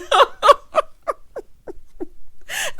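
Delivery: high, loud cackling laugh; real laughter. Real, not acted. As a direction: crack up at your own joke. Own laugh after the joke.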